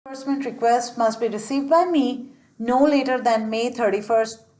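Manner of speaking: normal talking voice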